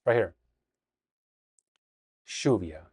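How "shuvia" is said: "Lluvia" as SHOO-vya, low and falling; Argentine pronunciation. Shown as a demonstration. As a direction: say "Lluvia" with a relaxed sh, lower. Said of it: "it's just easier for me to say".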